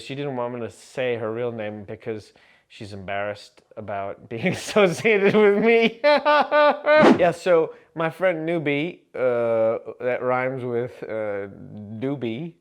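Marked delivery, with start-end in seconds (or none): 6.1-7.1 loud, high burst of laughter. Real, not acted. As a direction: crack up at his own joke. Laughs at own joke.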